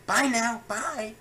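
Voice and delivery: high, slow sing-song goodbye; put-on chirpy voice; mock cheery farewell.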